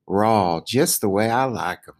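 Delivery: ordinary delivery, nothing marked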